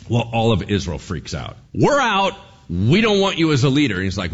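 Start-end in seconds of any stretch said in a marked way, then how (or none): 1.7-4.0 loud defiant crowd voice; loud defiant crowd refusing the king; angry crowd. Acted. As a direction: shout it back as the angry nation. Nation rejects king.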